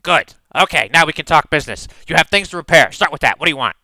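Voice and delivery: a tough little smith who wants money; brusque kobold smith. Acted. Kobold smith talks business.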